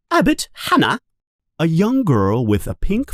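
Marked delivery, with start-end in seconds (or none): 0.1-1.0 high, ringing name call; teacher calling the first pupil. Acted. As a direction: call the name across the hall.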